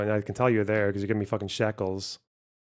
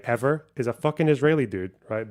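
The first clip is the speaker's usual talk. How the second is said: usual speaking voice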